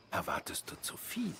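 breathy dismissive whisper. Acted. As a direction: drop to a whisper. Dismissive aside.

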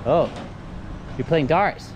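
ordinary talk, nothing marked

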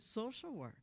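quoting mom's answer, flat and very quiet; dead serious mom. Retold or imitated. Mother telling her addict son her new career, dead serious and quiet. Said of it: "she looked at me so serious".

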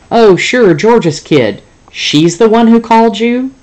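brash and eager down the line; zoo boss on the phone. Acted. Zoo boss chatting with the reporter, high and loud.

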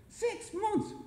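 very high, incredulous question; irritated disbelief. A narrator's reading. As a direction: squeak it back in disbelief. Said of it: "Jerry was starting to get irritated".

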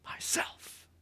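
breathy whisper, barely there; soft as the wind. A narrator's reading. Aslan answering the boy again, whispered softly. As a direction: breathe the word like a passing breeze. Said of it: "soft as the wind".

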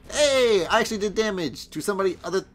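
high, loud, fast delighted shout; excited. Real, not acted. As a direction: burst out with surprise and joy. Excited at finally hitting someone.

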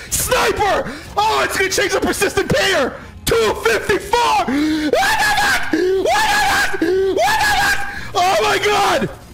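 high-pitched screams of disbelief; real ecstatic shouting. Real, not acted. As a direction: lose it over a huge win, voice shooting up. Yelling at a record win.

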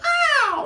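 sudden high, loud kung-fu yelp; Bruce Lee yelp. Retold or imitated. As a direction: let out a sharp martial-arts cry. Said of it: "a Bruce Lee noise".

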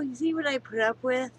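ordinary delivery

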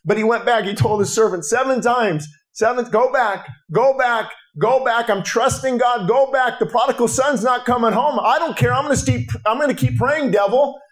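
high, insistent sermon delivery; impassioned preaching. Real, not acted. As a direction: drive the repeated phrases home like a revivalist.

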